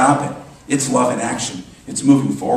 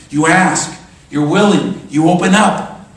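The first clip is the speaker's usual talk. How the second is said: loud, raised, punched short phrases; forceful preaching, each phrase landed hard; emphatic exhortation; 5 semitones higher, 6 dB louder, slower than usual. Real, not acted. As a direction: proclaim each step with rising conviction. Preacher builds list of steps.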